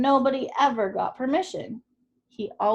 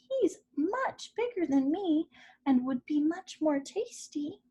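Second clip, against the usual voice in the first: tiny goat voice; 8 semitones higher than usual. A narrator's reading. Smallest goat talks troll out.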